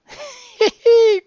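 loud, high-pitched laugh; amused high cackle; high giggle. Real, not acted. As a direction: crack up in a high squeal. Amused by the silly story.

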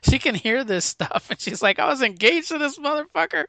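half-hearted panicked retelling; freaking out. Retold or imitated. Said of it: "Barbara's freaking out".

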